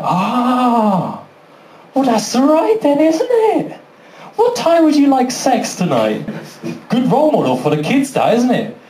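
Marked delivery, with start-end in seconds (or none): 0.0-6.3 high put-on wife voice; suddenly won over, pitched way up; mock placated wife. Wife accepting her husband's absurd gambling excuse, mock-appeased and high.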